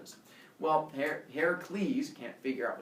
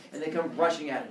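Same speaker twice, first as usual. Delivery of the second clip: ordinary delivery, nothing marked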